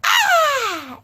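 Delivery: shrill ah of delight; high delighted shriek. Acted. Starla savoring her unicorn drink, high squeal.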